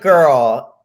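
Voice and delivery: loud, drawn-out mock scolding; acted-out exasperation, punched out loudly; exasperated retort. Host scolding the singer who feared for her voice, exasperated. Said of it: "This made me so mad".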